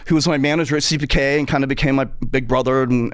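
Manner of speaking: normal talking voice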